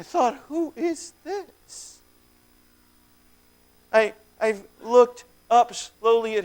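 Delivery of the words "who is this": a hushed, slow, awed question; awed whisper. Acted. Possessed man wonders who this stranger is, awed hush. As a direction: breathe it out in wonder.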